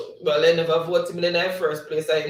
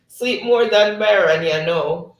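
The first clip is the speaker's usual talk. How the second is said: loud, raised voice after a laugh; amused, louder read-out through laughter; 4 semitones higher, 7 dB louder, slower than usual. Real, not acted. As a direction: burst out with it, laughing. Laughs reading teasing comment.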